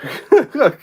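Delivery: high, loud laugh through the burn; pained laugh. Real, not acted. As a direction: laugh off the spicy heat. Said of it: "mouth is on fire".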